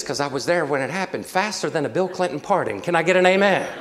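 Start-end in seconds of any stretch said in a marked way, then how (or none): 2.8-3.7 loud, high rallying shout; calling for amen. Real, not acted. Preacher to congregation after a pardon joke, loud rallying call. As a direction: belt it out to the whole congregation.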